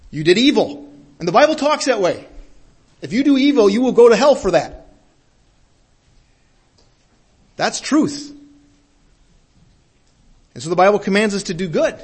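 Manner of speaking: high, loud preaching at full force; fiery preaching. Acted. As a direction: thunder it from the pulpit.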